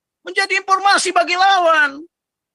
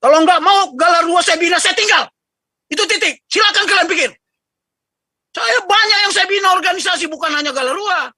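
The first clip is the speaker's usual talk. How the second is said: louder, sharp and final; harsh scolding; 3 semitones higher, 4 dB louder than usual. Real, not acted. Patron scolding the organisation members, loud and harsh. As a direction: slam the door on the discussion. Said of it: "Saya terpaksa keras ngomong karena tugas".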